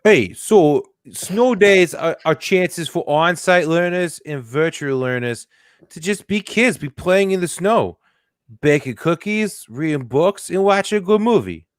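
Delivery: hammed-up New Jersey voice reading the news; New Jersey accent. Co-host reads snow day statement, mock New Jersey accent. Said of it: "That's your New Jersey accent?".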